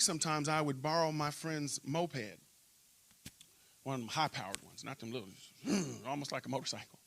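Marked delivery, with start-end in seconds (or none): none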